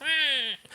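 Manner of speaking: high mock bird scream; imitated eagle screech. Imitating the stray eagle scream on the soundtrack, high thin screech. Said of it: "an eagle scream over the".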